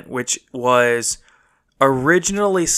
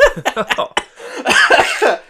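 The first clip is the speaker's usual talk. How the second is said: laughter; 12 semitones higher, 4 dB louder than usual. Real, not acted. Still laughing.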